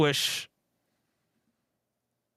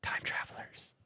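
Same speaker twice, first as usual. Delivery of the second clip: whisper; 14 dB quieter than usual. Real, not acted. Whispered aside.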